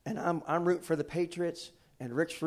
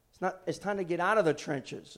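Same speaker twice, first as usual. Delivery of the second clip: ordinary delivery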